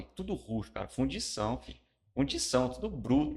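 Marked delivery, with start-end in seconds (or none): 2.1-3.3 high excited outburst; loud, high exclamation; exclaiming. Retold or imitated. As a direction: call it out with a burst of energy. Exclaiming rough foundry life.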